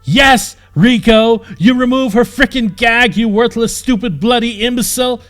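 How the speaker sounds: loud, high furious yell; screaming in rage. Acted. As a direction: hurl each insult with a stab. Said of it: "he screamed".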